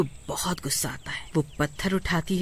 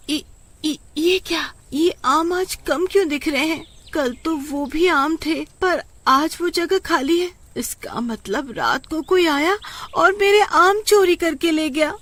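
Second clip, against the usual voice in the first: high, rising alarm at the missing mangoes; panicked disbelief, higher; old woman discovering mangoes stolen, upset and high; alarmed shock; 10 semitones higher, 4 dB louder than usual. Acted. Savitri alarmed at theft.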